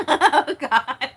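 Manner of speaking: loud, high, bursting with real laughter; helpless laughter. Real, not acted. Streamer blushing at the chat's teasing, helpless laughing exclamation. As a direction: gasp it out while cracking up.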